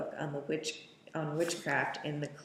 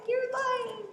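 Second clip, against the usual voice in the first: strained screech. Retold or imitated. Voicing Mia accusing the coven.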